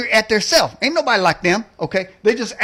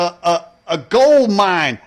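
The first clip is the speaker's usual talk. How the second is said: slow punchline; much slower than usual. Acted.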